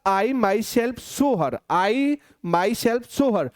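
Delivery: "I myself saw her" drawn out word by word, very slow; slow emphatic example. Shown as a demonstration. Teacher to students, example of the emphatic pronoun, very slow. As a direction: spell it out slowly for the class. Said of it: "लेकिन मैंने बोला".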